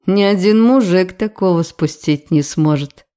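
plain reading voice

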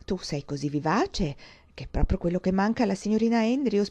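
plain reading voice

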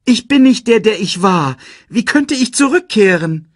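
very high, loud plea; desperate protest. A narrator's reading. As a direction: argue back urgently at full voice. Simba argues with his father. (verzweifelt, hoch und laut protestieren)

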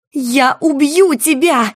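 slow, high cry through a mouthful of water; threatening yell while spitting water. Acted. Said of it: "Выплёвывая воду и угрожающе сверкая глазами, закричала". (кричит, отплёвываясь от воды)

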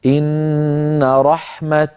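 very slow, رحمة held then stopped on the t. Shown as a demonstration. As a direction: draw out إن رحمة and stop on its ta. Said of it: "لو وقفنا عليها اضطرارا أو اختبارا نقول". (الوقف بالتاء على رحمة ببطء)

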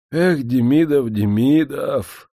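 медленно, со вздохом горечи; протянуть имя с тоской; сокрушённо. Acted. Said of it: "сокрушённо вздохнул помещик". (помещик сокрушается перед гостем, медленно и горько)

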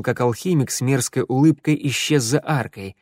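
plain reading voice

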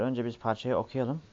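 ordinary talk, nothing marked

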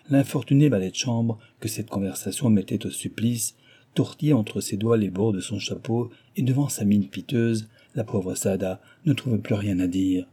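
ordinary delivery, nothing marked

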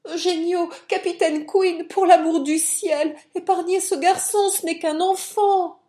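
high, loud frantic pleading; panicked high-pitched plea; terrified cry. A narrator's reading. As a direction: cry out in panic, begging for mercy. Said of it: "en proie à la terreur, jeta ses bras autour de lui en criant".

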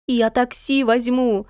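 high, drawn-out cry; eager cry. A narrator's reading. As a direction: call it out eagerly, pitch lifted. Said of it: "закричала Натка".

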